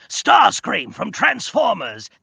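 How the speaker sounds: high, loud, slow villain voice. Shown as a demonstration. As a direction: proclaim it as a cartoon robot villain. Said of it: "one of the voices of".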